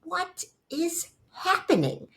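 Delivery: high slow drawn-out question; high slow aghast exclamation; appalled disbelief. Retold or imitated. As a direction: ask it stunned and horrified. Retold dismay at hospital.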